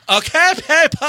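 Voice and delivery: high, loud effeminate squeal; hammed-up, overdramatic raid leader; drama queen act. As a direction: call the raid to order like a shrieking diva. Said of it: "two hours of".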